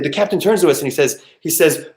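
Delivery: ordinary talk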